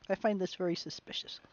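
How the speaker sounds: soft conspiratorial whisper. Real, not acted. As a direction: whisper it like sharing a suspicion. Aside suspicion to the audience.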